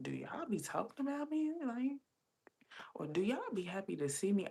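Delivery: high-pitched retold puzzlement; self-conscious questioning. Asking if pantry workers talk about her, self-conscious. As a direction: voice lifting in acted-out suspicion. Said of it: "so self-conscious".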